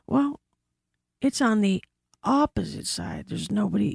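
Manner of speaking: usual speaking voice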